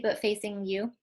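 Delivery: ordinary talk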